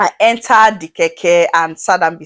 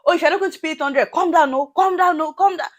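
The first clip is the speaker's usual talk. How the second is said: higher, fast mock driver barking orders; 9 semitones higher than usual. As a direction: hurry her out, pitched up and rapid.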